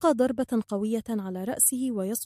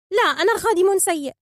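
high, loud protest; high-pitched, stubborn self-blame; insistent phoenix; 9 semitones higher, 8 dB louder than usual. A narrator's reading. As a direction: contradict her, upset, in a bird voice. Phoenix insists, contradicting her.

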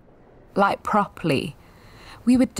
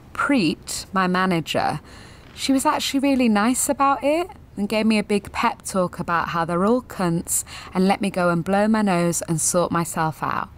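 ordinary talk

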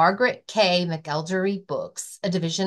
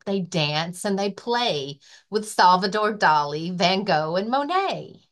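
lively, sing-song picture book reading; reading to children. Acted. Librarian reading a rhyming book to children, lively. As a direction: read it like story time for little kids. Library story time read-aloud.

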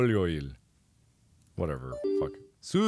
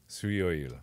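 ordinary delivery

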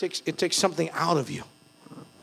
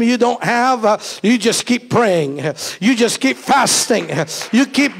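starts reassuring, climbs into a full shouted preaching cry; God's voice rising to a shout; 5 semitones higher, 7 dB louder than usual. Acted. Pastor voicing God's promise to the congregation, rising to a shout. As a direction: let God's promise swell louder and higher until you're shouting it.

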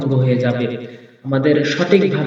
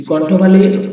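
ordinary talk, nothing marked

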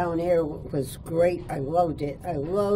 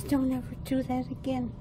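higher, softer voice of a kindly teacher gently scolding; a light hint of a caring teacher warning a child, pitched up and hushed; mild teacherly caution, higher and softer; teacher's warning; 6 semitones higher, 5 dB quieter than usual. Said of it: "she says".

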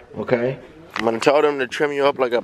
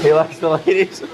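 amused surprise; 3 semitones higher than usual. Real, not acted. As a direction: ask it back, tickled. Repeating groomer's remark.

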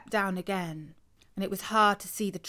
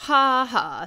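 deadpan; 4 semitones higher, 6 dB louder than usual. Acted. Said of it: "in a flat, deadpan sort of way".

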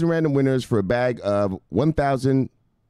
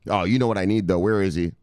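usual speaking voice

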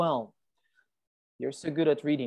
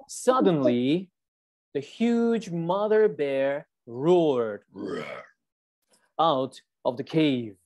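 slow, deliberate read-aloud; story reading; 3 semitones lower, much slower than usual. Acted. Teacher reading the bear story to the class, slowly.

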